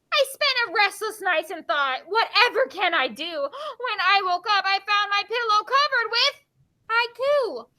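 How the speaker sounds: lifted, measured verse reading; poem reading. Acted. Teacher reading a poem to the class, lifted expressive read-aloud. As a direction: build suspense toward the last word.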